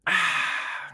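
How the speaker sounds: long refreshed exhale; satisfied sigh. Real, not acted. Host after a sip of Liquid Death, refreshed sigh. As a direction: let out an "ahh" after a sip.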